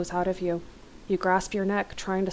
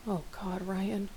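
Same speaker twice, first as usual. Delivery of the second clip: hushed, soft murmur of shock; whisper; 8 dB quieter, slower than usual. A narrator's reading. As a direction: say it softly under your breath. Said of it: "you whisper".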